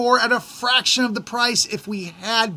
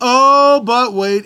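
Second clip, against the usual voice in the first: a loud, drawn-out oh; 3 semitones higher, 6 dB louder, much slower than usual. Real, not acted. Storyteller teasing that it gets worse, loud. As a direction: lean in hard on the oh before the twist. Building to the next twist.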